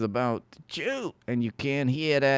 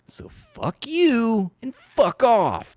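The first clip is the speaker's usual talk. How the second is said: mock cheerful; 11 semitones higher, 5 dB louder, much slower than usual. Real, not acted.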